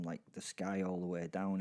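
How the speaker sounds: plain reading voice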